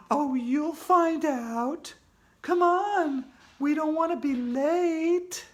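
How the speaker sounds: high, slow sly ghostly voice; teasing ghost. Acted. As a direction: tease him as you float off.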